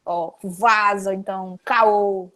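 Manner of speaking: slow, drawled Carioca slang, mock tough; Carioca attitude. Shown as a demonstration. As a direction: lean on it like a Carioca picking a fight. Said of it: "parece que eles estão é brigando". (sotaque carioca lento e briguento)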